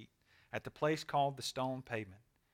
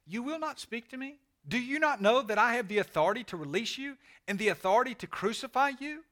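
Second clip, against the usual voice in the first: high, rising indignant questions; incredulous challenge; 8 semitones higher, 5 dB louder than usual. A narrator's reading. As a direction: press the silent prisoner, getting louder and faster. Pilate confronts silent Jesus in reading.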